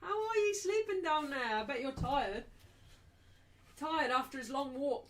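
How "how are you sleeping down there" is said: high, drawn-out cooing at a puppy; dog-talk voice. Real, not acted. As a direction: coo down at the dog lying below. Talking to the dog.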